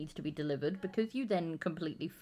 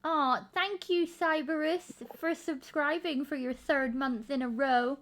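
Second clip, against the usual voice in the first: warm thanks; 7 semitones higher than usual. Real, not acted. Thanking a subscriber on stream.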